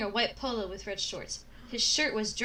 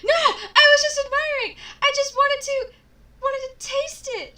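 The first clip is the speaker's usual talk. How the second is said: plain reading voice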